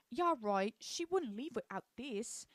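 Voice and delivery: loud British scoff, then drawn out; British accent. Retold or imitated. Patricia holding Joy's rabbit, scoffing British accent. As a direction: imitate a scoffing British girl. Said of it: "I keep doing that accent".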